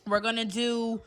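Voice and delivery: loud, excited hostess running a live draw; live giveaway host. Acted. Vlogger to her viewers during the prize draw, loud and excited. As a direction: hype the viewers through the spin.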